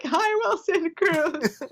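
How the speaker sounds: high, drawn-out fangirl hello; starstruck greeting. Retold or imitated. Fan greeting her favorite actor, starstruck and high. Starstruck fan's retold reply.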